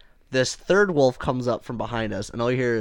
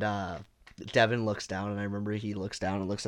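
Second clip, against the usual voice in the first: ordinary talk, nothing marked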